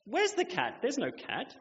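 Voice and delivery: put-on high, puzzled little girl voice; confused little girl. Little girl at the tiger asking where the cat is, high and puzzled. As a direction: sound baffled like a three-year-old. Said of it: "she's like".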